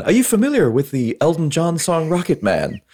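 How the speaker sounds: high, over-enunciated movie-hero question. Retold or imitated. Quoting the hero in the final rocket showdown, high and mannered. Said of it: "[name] mentions the [name] song".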